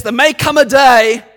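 loud, slow battle cry speech; rally the troops before battle; roaring rallying speech. Retold or imitated. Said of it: "he says this".

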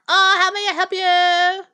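high, slow put-on greeter voice; mocking salon greeter. Friend mimicking a nail salon greeter, high and slow. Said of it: "and they're like".

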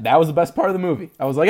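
ordinary talk, nothing marked

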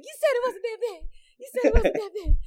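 high, loud whiny accusation, laughing; whiny complaint. Retold or imitated. Mom complaining to her son, high and whiny. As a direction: wail it high and betrayed. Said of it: "I was like".

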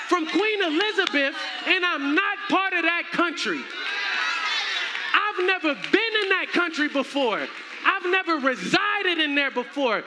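high, loud, emphatic preacher shout; preaching at full force. Real, not acted. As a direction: drive the point home to the congregation.

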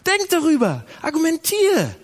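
loud, high, urgent command; urgent preaching shout. Acted. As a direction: hammer the command home. Preacher urging congregation. (lauter, hoher Predigtruf)

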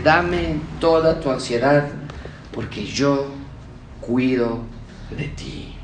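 slow, soft, dying into a whisper; God reassuring the believer, slow and fading to a whisper; tender God voice. Acted.

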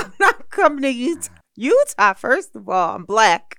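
hammed-up indignant protest; mock outraged refusal. Guest retelling her refusal to move, loud and outraged.

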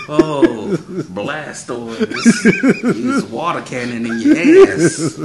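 high, loud laughing shout; laughing battle cry. Pretend. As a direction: yell it like a Pokemon trainer. Mock Pokemon battle call, laughing.

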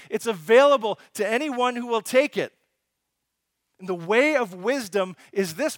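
loud, impassioned sermon, hammering each line; full-force preaching. Acted. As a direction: preach it out to the whole church.

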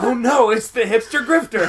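high, loud mock panic; mock damsel in distress. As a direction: cry out like a cartoon victim. Mock villain-victim cry.

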